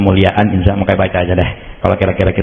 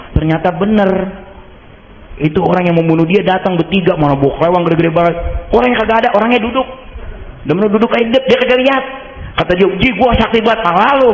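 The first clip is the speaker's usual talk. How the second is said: much higher, animated retelling; excited storytelling; 11 semitones higher, faster than usual. Retold or imitated. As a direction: build up the amazing escape. (bercerita dengan antusias)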